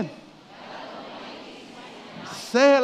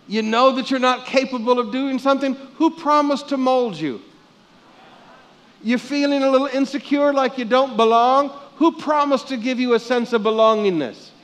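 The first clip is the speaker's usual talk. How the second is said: loud rising preacher questions; preaching at full force; 6 semitones higher, 7 dB louder than usual. Acted. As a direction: drive each question home to the crowd. Pastor preaching rhetorical questions.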